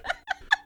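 very high squeal of laughter; real laugh. Real, not acted. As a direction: break into a squeal. Punchline about roommate.

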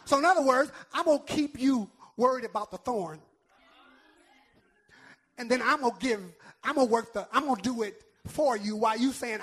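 loud, rhythmic pulpit delivery; punchy shouted sermon cadence; full-force preaching. Acted. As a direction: hammer each phrase home to the congregation.

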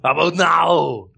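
slow Austrian-accented mock shout; drawn-out, hammed-up Austrian action-star voice; Arnold Schwarzenegger. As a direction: bellow the punchline in a thick Austrian accent. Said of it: "in an Arnold Schwarzenegger voice".

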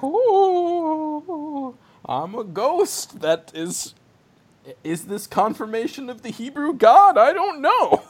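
high, hammed-up ghost voice; mock ghost. Ghost asks about the Hebrew God in a mock high voice.

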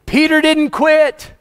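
high, loud and slow, every word driven home; emphatic preaching. Real, not acted. Preacher to congregation, emphatic, slow and loud. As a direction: proclaim it so no one forgets. Preacher stressing a key point.

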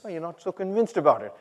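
normal talking voice

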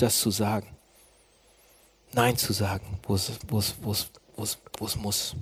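low, softened closing prayer. Acted. Preacher thanking Jesus and asking for courage, quiet prayer.

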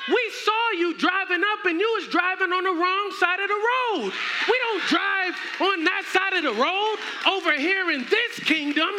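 high, loud mock outrage; indignant rejection. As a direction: play an offended royal turning away an impostor. Acted royals list his wrongs.

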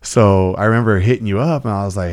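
ordinary delivery

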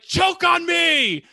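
high hammed-up yell; mock shriek. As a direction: belt it out in a silly high shriek. Imitating heroine's last line, yelled.

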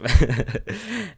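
high, loud burst of laughter. Real, not acted. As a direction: let a big laugh burst out. Laugh after saying fuck off.

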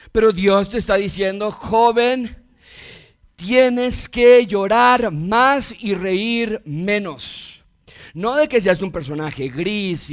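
high, slow pulpit proclamation; emphatic preacher voice; forceful preaching. Real, not acted. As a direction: draw each word out as a solemn command.

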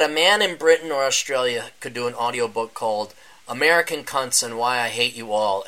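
normal talking voice

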